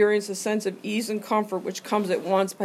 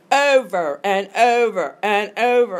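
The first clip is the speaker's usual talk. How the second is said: slow, louder, each over hammered; imitating the speaker; 7 dB louder, slower than usual. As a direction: mimic a speaker pounding the repetition. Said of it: "he talked like this".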